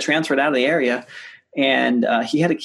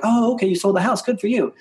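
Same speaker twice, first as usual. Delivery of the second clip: mock cheerful; 9 semitones higher than usual. Feigned congratulation to neighbor.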